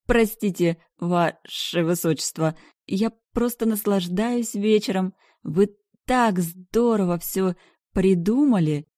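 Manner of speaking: high, slow giddy gush. Acted. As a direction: gush like you're tipsy and happy. High heroine gushing to king.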